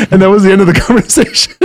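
quick punchline broken up by laughing; real laugh bubbling through the words. Real, not acted. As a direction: talk through real laughter, high and loud. Laughing at own story's punchline.

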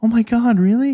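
high, loud mock astonishment; astonished. Mother hears friend works with her son, acted astonishment. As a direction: imitate her amazed reaction, pitch leaping up. Mother's surprise at small-world link.

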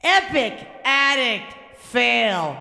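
slow, drawn-out show announcer. Pretend. Hosts her own podcast, announcing the segment in a slow announcer voice. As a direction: boom out "Epic Addict Fail" like a game-show host. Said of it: "Like how I did that with my voice".

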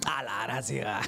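soft chuckle at his own joke; laughing. Real, not acted. Preacher chuckling at his own joke to the congregation. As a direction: drop into a low, quiet laugh.

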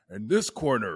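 loud booming fight-night intro; boxing ring announcer. Pretend. Host introduces himself for a mock podcast-voice battle, booming announcer. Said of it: "battle of the podcast voice".

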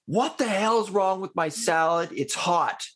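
mock angry complaint. Customer griping at waiter, mock angry. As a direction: bark it like a rude customer. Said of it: "than if you're like".

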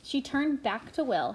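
ordinary delivery

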